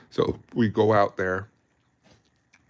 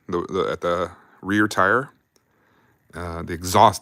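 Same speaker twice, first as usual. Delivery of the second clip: usual speaking voice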